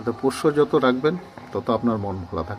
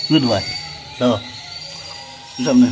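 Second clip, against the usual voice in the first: squeaky praising a dog; 2 semitones higher, 7 dB louder than usual. Acted. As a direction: coo praise at the pet. Praising the cat.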